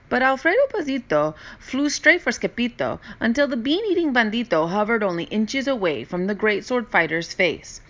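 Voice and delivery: ordinary delivery, nothing marked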